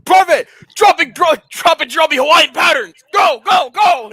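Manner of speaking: high, loud barked orders; drill sergeant shout. Pretend. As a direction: bark orders at a recruit.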